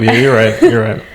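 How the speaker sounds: ordinary talk, nothing marked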